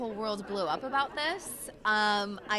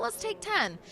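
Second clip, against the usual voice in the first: sing-song break; 10 semitones higher than usual. Retold or imitated. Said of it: "And I was like".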